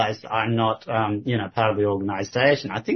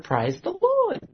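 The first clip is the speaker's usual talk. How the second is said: high, breezy mock church-lady voice; imitating her reply; slower than usual. Mimicking the sister's brush-off reply, high and breezy. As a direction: toss it off in her sing-song way, up high. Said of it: "She responded".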